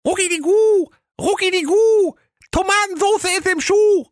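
tiny high-pitched chirping chant, swelling at line ends; twittering little cheeses. A narrator's reading. Little cheeses warning the rider from the tree, shrieky high twitter. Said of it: "Doch die Käslein wiederholten".

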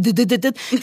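rapid mimicked "dit-dit-dit" of shaking feet; imitated trembling feet. Driving candidate mimicking her shaking feet on the pedals, rapid sputter. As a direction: tap out nervous feet with the voice. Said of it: "Meine Füße machten".

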